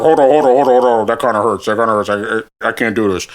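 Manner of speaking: a lightly voiced dad, pained, backing off the ride; half-hearted imitation of dad wincing and giving up. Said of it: "he's like".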